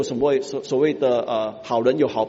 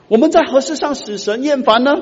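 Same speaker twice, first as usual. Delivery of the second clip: much higher, louder indignant question; indignant crowd; 11 semitones higher, 6 dB louder than usual. Acted. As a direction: protest like the offended crowd. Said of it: "会众就问马拉基说". (会众不服气地反问)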